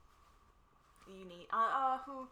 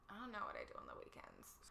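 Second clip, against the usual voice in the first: put-on Valley girl whisper; 10 dB quieter than usual. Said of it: "in a Valley girl voice".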